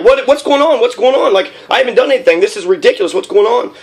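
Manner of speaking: high, frantic, confused pleading; a student grabbed by cops who can't believe it; panicked protest. Retold or imitated. Student struggling with campus police, high panicked pleading.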